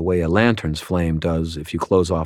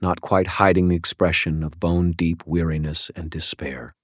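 ordinary delivery, nothing marked

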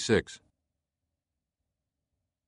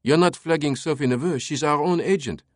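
put-on higher French-accented objection; protesting lieutenant; 6 semitones higher than usual. A narrator's reading. Lieutenant defending a fellow agent to his captain, protesting. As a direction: object in a raised pitch.

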